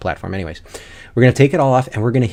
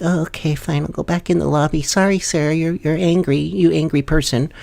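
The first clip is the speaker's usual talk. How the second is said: lightly higher, timid apology; meek backing down; 7 semitones higher than usual. Retold or imitated. Imitating a player meekly retreating to the lobby, mildly timid. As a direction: shrink away and give in. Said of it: "they just kinda shrink".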